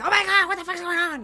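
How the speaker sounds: high, loud panicked outburst; imitated panic. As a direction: freak out like a panicking mom. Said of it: "not good with, like, panic situations".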